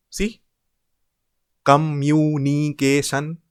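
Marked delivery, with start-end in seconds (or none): 1.6-3.4 communication slowly stretched as com-mu-ni-ca-tion; five drawn-out even beats in communication; syllable by syllable. Shown as a demonstration. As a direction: chant communication slowly, one syllable at a time. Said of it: "Five syllables".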